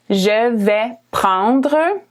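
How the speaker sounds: "Je vais prendre" drawn out slowly; slow model phrase. Shown as a demonstration. Teacher to learners ordering food, slow model. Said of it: "in French, you're gonna say".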